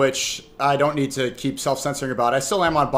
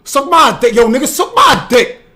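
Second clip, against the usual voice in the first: loud, high-pitched shout; głośny okrzyk; 8 semitones higher, 9 dB louder than usual. Shown as a demonstration. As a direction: yell it as a brash demonstration. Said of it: "You wanna say".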